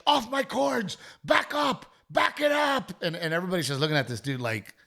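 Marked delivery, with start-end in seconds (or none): 0.1-3.0 high, strained mock yell at the performers; imitating a stagehand's high-pitched panicked yelling. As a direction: squeak it out like a frantic cord guy guarding his cables. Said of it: "he's yelling at them".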